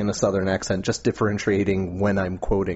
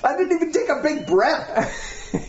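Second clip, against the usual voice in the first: laughing. Real, not acted. Laughing after flub.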